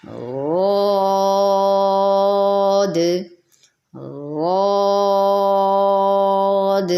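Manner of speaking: غاد held long on a straight alif, no w sound; drawn-out heavy ghaad. Shown as a demonstration. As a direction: stretch غاد out, heavy, from the side of the tongue. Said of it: "ان کے مخرج سے ہی ادا کر کے ان کو موٹا".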